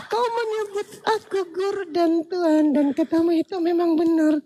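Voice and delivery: high, drawn-out put-on lady voice; hammed-up high feminine deity; female God. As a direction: greet the world in a coy falsetto. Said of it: "Langsung turunnya Tuhan yang perempuan".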